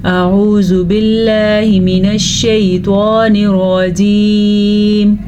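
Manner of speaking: slow, drawn-out tajweed recitation; Quranic recitation. Acted. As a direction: recite slowly and melodically. Recitation before the lesson. (धीमी तिलावत)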